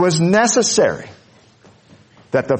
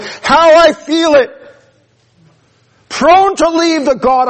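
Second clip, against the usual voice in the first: much higher and louder, slow and fervent; impassioned hymn lines; 11 semitones higher, 5 dB louder than usual. Real, not acted. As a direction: cry the hymn words out to God, pleading. Preacher breaks into hymn lines.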